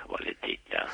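breathy seductive whisper; susurro seductor. Acted. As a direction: whisper it like a lover. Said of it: "Le digas boletita". (susurro lascivo)

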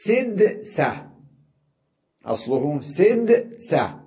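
سدسة said slowly with weight on each syllable; stressed original form. Shown as a demonstration. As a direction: lean on سدسة so the class hears it. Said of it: "ما الدليل على أصله سدسة". (تشديد على كلمة سدسة)

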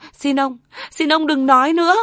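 high, loud, choked begging; sobbing plea. Acted. Wife sobbing, begging her husband to stop talking. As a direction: beg him through a sob to stop. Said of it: "Bà Tâm nấc lên".

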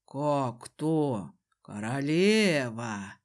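low, drawn-out man's voice; admiring Desmond. A narrator's reading. Desmond calls the heroine queen, low and admiring. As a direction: say it deep and slow, savouring it.

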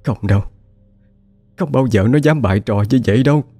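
high, distant, trembling insistence; grief-stricken certainty. Acted. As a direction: sob out the truth she can't deny. Grieving mother insists daughter dead. (giọng cao, run rẩy, đau đớn khẳng định)

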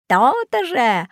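high, drawn-out gloating creature; monster's reply. A narrator's reading. Ugly beast answers before vanishing, drawn-out reply. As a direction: stretch it out high in triumph. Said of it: "ответила уродина".

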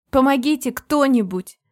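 loud, high call for help; shouting for help. A narrator's reading. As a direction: yell out to anyone nearby. Heroine calls out for help.

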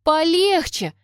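high, loud protest; sharp indignant exclamation. A narrator's reading. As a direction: snap it out indignantly. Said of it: "возмутилась я".

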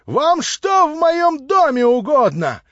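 put-on shrill slow screech of the drunk landlady; shrieking landlady. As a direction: screech it at the intruder like an outraged harridan. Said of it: "завизжала она".

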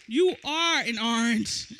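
normal talking voice

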